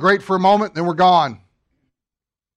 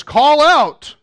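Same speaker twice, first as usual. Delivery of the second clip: high, loud proclaiming call; ringing command from the pulpit; 7 semitones higher, 7 dB louder than usual. Acted. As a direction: call it out like a herald. Voice in scripture calls out.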